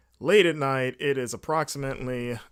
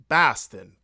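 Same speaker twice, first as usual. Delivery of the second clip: loud attempt at a Boston accent; 2 semitones lower, 8 dB louder than usual. Shown as a demonstration. Host trying out the local accent for his trip, Boston accent. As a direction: hit the word Boston in a Boston accent. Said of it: "Boston accent".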